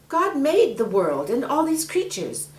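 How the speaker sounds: put-on girl Mary. Mary asks the snake for help, eager. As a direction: ask sweetly and eagerly.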